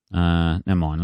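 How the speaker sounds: ordinary talk, nothing marked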